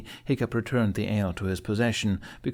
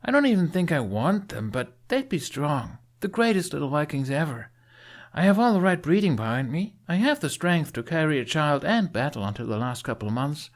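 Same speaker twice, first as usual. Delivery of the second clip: put-on higher, earnest young warrior woman; Astrid's voice; 7 semitones higher than usual. A narrator's reading. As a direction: boast a little, proud but unsure.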